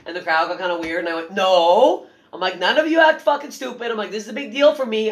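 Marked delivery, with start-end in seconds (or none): 1.3-2.1 a sharp, loud no to the room; comic at a taping stopping a weird crowd, loud and firm. Retold or imitated. 2.6-5.1 high, loud, bossy rant at the audience; comic warning a taping audience not to blow it, loud; mock scolding the crowd.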